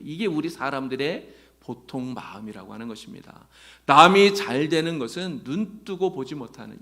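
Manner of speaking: normal talking voice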